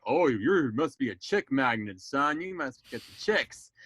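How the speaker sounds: slow, lightly voiced proud father; teasing dad. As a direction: lightly imitate Dad ribbing his kid, drawing it out. Voicing Dad teasing his child.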